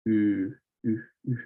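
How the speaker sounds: oo repeated as a French u, tongue moved forward; repeated French u vowel; drilling the French u. Shown as a demonstration. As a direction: drill the sound over and over. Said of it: "just make it oo".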